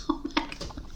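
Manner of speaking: breathless giggle, whispered; whispered laugh. Real, not acted. Laughing at her repeated fails, whispered. Laughing after another fail.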